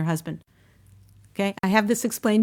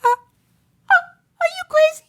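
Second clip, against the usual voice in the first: much higher, half-crying, half-laughing; mock outrage; 4 dB louder than usual. Wife mock-outraged at thanking husband for a plate, laughing. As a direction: shriek it in mock disbelief. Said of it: "I'm supposed to say".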